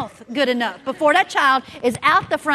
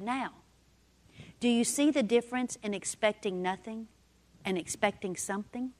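gentle inner voice; 2 semitones lower, 8 dB quieter than usual. Retold or imitated. The Lord speaking quietly to the mother in Dairy Queen, hushed. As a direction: let it drop in quietly like a whisper to the heart. Voicing the Lord's quiet word.

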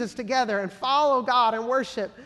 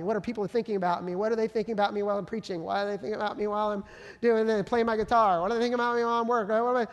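racing anxious thoughts; faster than usual. Retold or imitated.

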